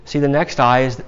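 ordinary delivery

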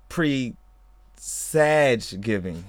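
slow punchline; drawn out. Real, not acted. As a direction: stretch the pun out slowly. Punchline of pun.

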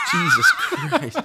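high, loud burst of laughter; cracking up at the suggestion; laughing hard. Real, not acted. Reacting to Charlie Day pick.

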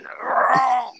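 high, loud imitated roar; mock roar. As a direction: roar like the attacker at the door. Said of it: "and he roared".

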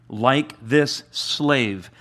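like this slave drawn out slowly; very slow emphasis. Real, not acted. Preacher slowing down to stress the point. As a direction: stretch each word. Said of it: "slowed delivery".

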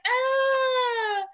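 a hammed-up high-pitched cry; mock wail. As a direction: throw out a wailing "ah". Mock dismay at Kenny's reply.

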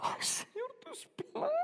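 very high, soft crying falsetto; mock tearful Paul. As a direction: play Paul weeping before the Lord. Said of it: "lo va a tener llorando ahí". (Pablo llorando, voz aguda y quebrada)

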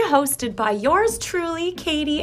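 high, very slow host voice; sing-song intro. Acted. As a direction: stretch each word out playfully. Drawn-out podcast host intro.

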